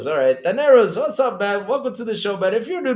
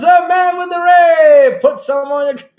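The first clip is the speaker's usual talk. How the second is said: hammed-up shout; 11 semitones higher, 12 dB louder than usual. Streamer hollering a line for the chat, shouted. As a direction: holler it out at full volume. Bellowed outburst breaking into laughter.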